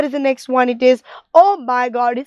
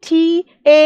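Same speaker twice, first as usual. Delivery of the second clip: slow spelling. Shown as a demonstration. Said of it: "So M O".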